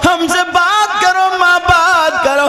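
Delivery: impassioned religious storytelling; fervent preaching. A narrator's reading. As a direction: preach the story at full force to the crowd.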